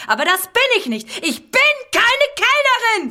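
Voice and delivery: high, loud outraged shriek; shrieking protest. Acted. Woman shrieks at the guests that she is no waitress. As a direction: scream it, refusing to be the waitress. Insistence breaks into shout.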